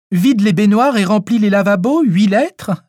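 high-pitched, pressing question; insistent. A narrator's reading. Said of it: "Hélène insista". (avec insistance)

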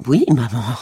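high, bright cry of joy; joyful cry. A narrator's reading. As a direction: call out overjoyed. (cri joyeux, plus aigu)

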